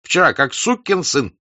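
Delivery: loud, high furious yell; angry shout. A narrator's reading. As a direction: shout out his bad luck. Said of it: "закричал Денисов".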